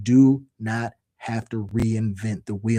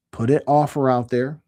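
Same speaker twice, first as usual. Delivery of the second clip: ordinary talk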